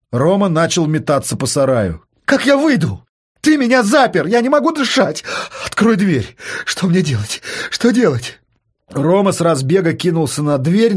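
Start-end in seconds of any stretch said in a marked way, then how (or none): 2.3-8.4 high, desperate cries; trapped, frantic and losing hope; panicked outburst. Acted. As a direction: plead in panic, pitched up. Panics in locked shed.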